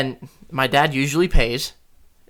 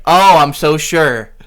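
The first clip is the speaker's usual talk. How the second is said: sarcastic; 2 semitones higher, 9 dB louder, slower than usual. Retold or imitated. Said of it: "And she's like".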